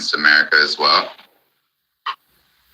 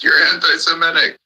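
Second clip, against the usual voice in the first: high-pitched mocking whine, much higher than his own voice; 12 semitones higher than usual. Ranting vlogger mimics people he argues with, high mocking whine. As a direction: parody the whiny complaint high up.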